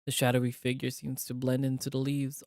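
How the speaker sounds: ordinary delivery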